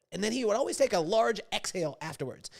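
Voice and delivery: ordinary talk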